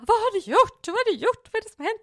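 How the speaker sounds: mycket högt och starkt upprört; upprörd pappa. Retold or imitated. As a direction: spela chockad pappa. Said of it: "pappa kom ju upp och bara". (pappa upprörd över bilen, högt och ljust)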